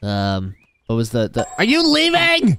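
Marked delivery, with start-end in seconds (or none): 1.6-2.6 high, drawn-out startled question; alarmed disbelief. Pretend. Player catching Speaker Woman leaving, alarmed. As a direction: squeal it in dismay. Sees his friend about to leave.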